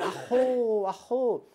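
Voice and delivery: put-on high, playful coo at the baby, with a laugh. Visitor holding the couple's baby, cooing playfully. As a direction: coo it like you're making a baby giggle. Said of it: "para hacer la gracia".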